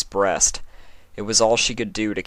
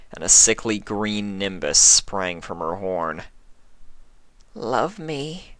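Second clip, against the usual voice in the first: hungry changeling. Acted. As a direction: lure her with false love.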